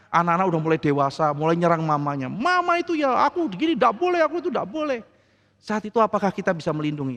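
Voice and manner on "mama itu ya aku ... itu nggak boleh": put-on whiny child. Child complaining about mother.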